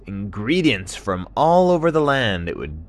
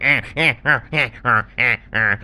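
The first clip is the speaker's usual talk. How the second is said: loud rhythmic "eh" puffs; ostrich running grunts; 3 semitones higher, 5 dB louder than usual. Acted. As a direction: grunt along as if trotting.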